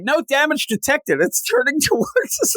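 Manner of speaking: very high alien computer readout, rising to a squeal; ship computer voice. Retold or imitated. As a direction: read it like a tinny ship system announcing doom. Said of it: "they're like".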